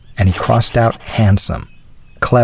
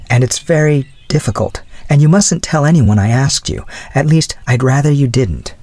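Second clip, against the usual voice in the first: lightly voiced young woman, pitched higher; higher girlish voice, confiding; earnest young woman; 7 semitones higher than usual. A narrator's reading. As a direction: raise the pitch for a hesitant, earnest girl.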